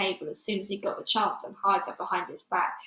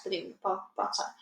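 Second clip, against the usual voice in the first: ordinary delivery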